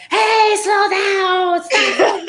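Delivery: high-pitched, drawn-out mock yell; mock road-rage scream. Driver yelling to the other car, mock scream. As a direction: yell at another car, playing it up for laughs. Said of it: "screaming out the window".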